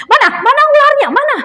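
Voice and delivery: acted-out high, loud demand; urgent searching. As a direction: burst in hunting for the snake. Rushing in to find the snake. (menirukan seruan mendesak mencari ular)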